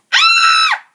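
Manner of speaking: piercing high loud scream. A narrator's reading. Goldilocks screaming at the three bears. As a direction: shriek at full pitch. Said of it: "She screamed".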